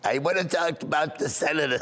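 mock-indignant Boca lady; indignant old lady. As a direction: deny it in character as the elderly caller. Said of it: "And I went".

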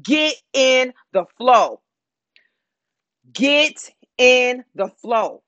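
God's invitation, slowed and spaced out; slow, drawn-out command. Retold or imitated. Preacher voicing God, calling the church into the flow, slow. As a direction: stretch each word of it, with long pauses. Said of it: "God said".

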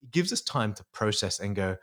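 ordinary talk

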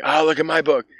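high, smug mocking tone; mock smug believer. One group bragging about their holy book, high mock voice. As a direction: show off the book. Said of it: "they're like".

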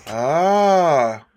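audience ahh, rising in pitch; a crowd's swelling ahh. Acted. Audience gasping at the fork, a rising ah. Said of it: "with it rising".

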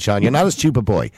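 ordinary delivery

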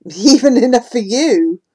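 teasing with a laugh. Acted. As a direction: laugh through the tease.

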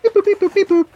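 high mock beep-boop dialing noise; high chirpy beep boop; phone dial beeps. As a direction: make silly beeps like dialing a call.

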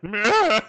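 short, high comic scream; mock scream. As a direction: imitate his hilariously bad scream. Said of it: "his scream is hilarious".

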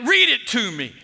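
loud, high command; acted-out pastor's order; retold demand. Pastor demands to hear the letter.

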